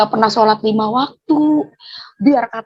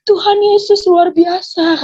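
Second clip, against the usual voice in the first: high, loud, drawn-out proclamation; raised voice, stretched-out exclamation; retold shout; 6 semitones higher, 5 dB louder, slower than usual. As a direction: imitate her shouting it out, slowly. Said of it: "Makanya tadi dia teriak".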